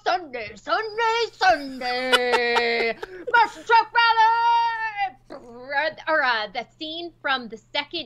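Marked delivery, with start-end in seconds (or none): 0.0-5.4 booming high announcer hype, stretched out; monster truck ad. Retold or imitated. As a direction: hype it like a Sunday rally ad.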